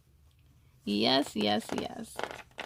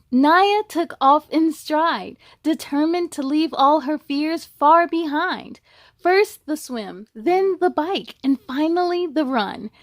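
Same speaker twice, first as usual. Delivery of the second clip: rhyming storybook read with a steady lilt; picture-book narration; reading to children; 4 semitones higher, 9 dB louder than usual. A narrator's reading. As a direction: read it to a class of kids. Reads a picture book aloud.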